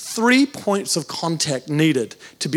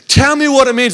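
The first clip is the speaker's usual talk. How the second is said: higher-pitched, impatient mock plea; exasperated demand; 5 semitones higher, 4 dB louder than usual. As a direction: demand the answer after waiting too long. Pleads with Germans after two years.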